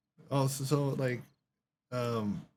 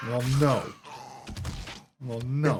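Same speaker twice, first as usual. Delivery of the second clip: louder, emphatic retold "no"; firm refusal; 2 semitones higher, 4 dB louder than usual. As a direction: put your foot down in a retold argument. Acted refusal to rescue a cat.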